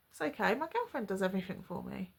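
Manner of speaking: soft, quick, casual repeat; offhand retold reply. Boyfriend repeats his confession to her, casually and quietly. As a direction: toss it off quietly, as if it's obvious.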